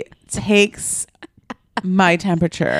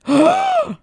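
sharp gasp. Retold or imitated. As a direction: gasp as if the doctor just walked in. Retold moment of shock.

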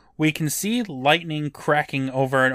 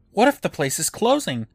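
higher, fretful worried voice; mildly panicky Eddie; 6 semitones higher than usual. A narrator's reading. Eddie worries to Sue about being locked in, mildly panicky. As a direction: fret lightly in a raised pitch. Sue tells him to chill the fuck out.

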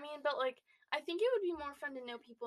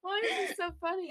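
laughing; 6 semitones higher, faster than usual. Real, not acted. Laughing fit.

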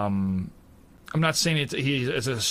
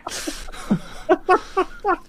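high airy laugh. Real, not acted. Laugh in the middle of the mock rant.

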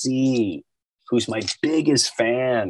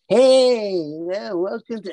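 higher, chirpy mock welcome; mock welcoming host; 9 semitones higher than usual. Imitating Norm greeting the new cast, higher and mocking. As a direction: sing out the welcome. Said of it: "you just figure".

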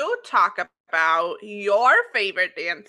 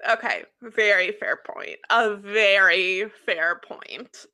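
ordinary talk, nothing marked

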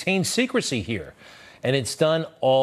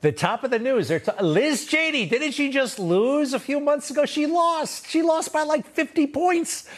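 high-pitched, mounting disbelief; incredulous outburst; 14 semitones higher than usual. Real, not acted. Host scoffing at news of Liz Cheney's interview, incredulous. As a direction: climb ever higher in scornful disbelief.

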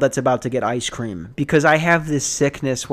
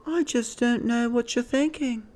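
put-on high, softer British accent; British lady; 10 semitones higher, 6 dB quieter than usual. British lady to leasing agent, high British complaint. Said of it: "She had a British accent".

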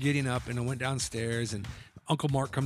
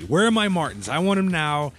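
pitched up and louder, demanding the boots; mock scream; 4 semitones higher, 6 dB louder than usual. Teen demanding his gift from the family, mock yell. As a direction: howl it out like a spoiled teen. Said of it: "I wanna scream it at everybody".

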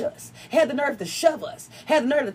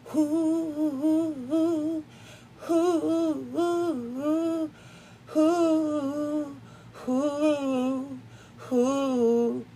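high wavering "hoo" like singing wind; long, high, hooted notes mimicking the wind; singing-wind imitation; 5 semitones higher, much slower than usual. Podcaster imitating the wind her niece took for singing, high melodic hooting. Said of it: "it sounded like somebody was just".

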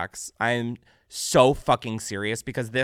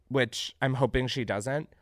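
ordinary talk, nothing marked

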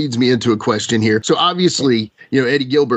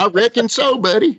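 high, hammed-up Tennessee twang; put-on Southern accent; mock Tennessee accent; 10 semitones higher than usual. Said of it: "lose my Tennessee accent".

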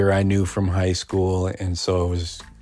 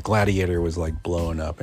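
normal talking voice

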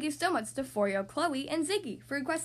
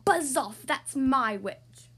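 loud, slow monster growl; growling beast; 6 dB louder, much slower than usual. Acted. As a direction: growl a threat as a terrible beast. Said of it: "a yowl and a growl and a croak".

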